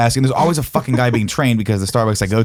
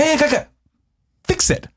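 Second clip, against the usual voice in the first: much higher, exasperated demand; mock irate demand; 14 semitones higher than usual. Customer ordering the manager to fix it. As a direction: jab it at the manager.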